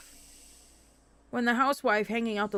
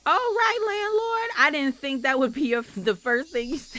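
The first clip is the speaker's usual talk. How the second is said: housewife character; 5 semitones higher than usual. Acted. Housewife answers the landlord's first remark, in character. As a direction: play the chatty housewife, pitched up.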